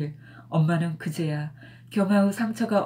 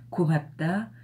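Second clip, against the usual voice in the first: usual speaking voice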